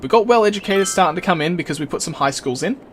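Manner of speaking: ordinary talk, nothing marked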